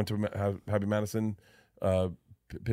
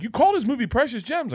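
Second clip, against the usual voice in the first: mocking friend; 13 semitones higher, 5 dB louder than usual. Big Jay mocking Bert.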